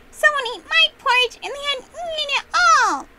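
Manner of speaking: put-on very high, loud baby bear wail; tantrum. A narrator's reading. Baby Bear banging on the floor over porridge, screeching. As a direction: wail it like a small child in a tantrum.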